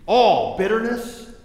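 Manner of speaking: loud, slow, weighty; loud scripture reading. Retold or imitated. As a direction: lean into each word. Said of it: "Verse thirty-one, look at this".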